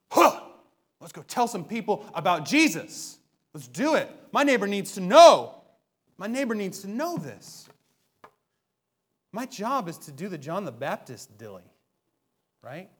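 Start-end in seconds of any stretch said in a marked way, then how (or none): 0.1-7.7 louder, eager call to action; rally your friends to go; fired-up. Retold or imitated. Listener inspired after the sermon, fired-up loud eagerness. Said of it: "I want it to be".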